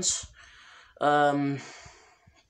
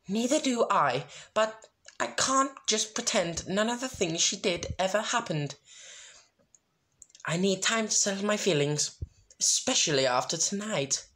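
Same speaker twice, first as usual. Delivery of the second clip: higher, prim ladylike voice; Rarity voice; 7 semitones higher than usual. Acted. Rarity telling her friends she needs time, in a lightly raised prim voice. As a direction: speak as a refined fashionista.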